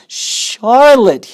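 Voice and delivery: high, loud, drawn-out moan of grief. Acted. Pig grieves over his dying friend, loud high moan. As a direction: wail her name in sorrow. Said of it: "he moaned".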